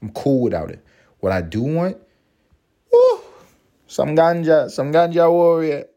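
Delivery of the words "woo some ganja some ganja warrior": higher, louder hype for ganja; eager craving. Real, not acted. Host hypes the weed he wants after a sober month. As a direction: call for it like a rallying cry.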